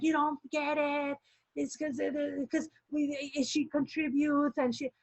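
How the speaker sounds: high, strained imitation of yelling, kept soft; mock screaming. Retelling the director screaming at a member, mock yell. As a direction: imitate his scream without the volume. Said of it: "he was screaming at me".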